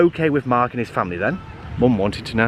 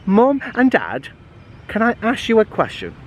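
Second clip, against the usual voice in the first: blurted out; 7 semitones higher than usual. Acted. Blurting out the question.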